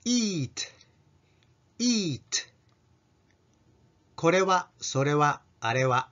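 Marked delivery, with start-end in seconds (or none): 0.0-2.2 higher-pitched, falling "eat" with long tense ee; tongue-raised long ee. Shown as a demonstration. Teacher modelling the word for taberu, "eat" with tense ee. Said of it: "食べるは舌を上げてeat".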